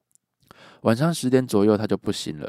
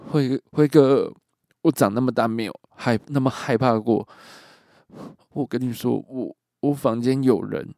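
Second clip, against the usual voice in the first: stammering, tearful and higher; retold tearful stammer, louder; on the verge of tears; 4 semitones higher, 5 dB louder than usual. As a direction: imitate a frightened friend about to cry. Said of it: "小雨带着哭腔说".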